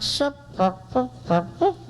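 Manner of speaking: tiny high peeps in short puffs. Retold or imitated. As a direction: squeak out little separate syllables. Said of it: "a girl with a soft voice".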